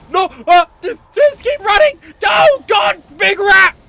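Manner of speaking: loud scared yelling; panicked shout. Real, not acted. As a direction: scream while fleeing. Chased, screaming.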